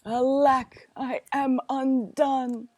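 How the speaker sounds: put-on loud, slowed cry of a ruined old man; a hint of the tailor's despair. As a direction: lament it.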